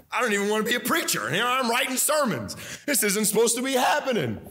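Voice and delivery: high, fast panicked outburst; a startled preacher realizing he's writing sermons; alarmed outburst. Retold or imitated. Preacher acting out his own panic.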